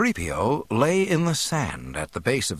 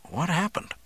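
put-on higher-pitched, puzzled young man; dazed mutter; 9 semitones higher than usual. A narrator's reading. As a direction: ask it as if just coming round. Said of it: "and muttered".

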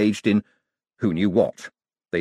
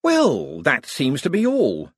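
drawn-out, higher-pitched sarcasm; sarcastic; 8 semitones higher, 3 dB louder, slower than usual. A narrator's reading. Visitor after getting no answers from the wizard, sarcastic. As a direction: stretch it out with mock satisfaction. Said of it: "said Moist sarcastically".

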